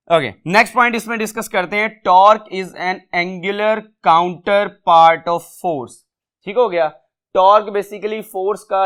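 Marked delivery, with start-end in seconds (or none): 2.0-6.0 slow and a little louder, word by word; slow, emphatic read-out of a definition; slow dictation. Shown as a demonstration. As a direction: dictate each word for the class. Reads statement for notes.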